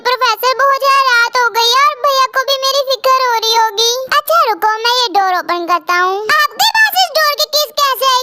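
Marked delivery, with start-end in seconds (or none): 4.8-6.2 low, easy male voice; a hint of a calm man; lightly lowered professor voice; lightly voiced professor.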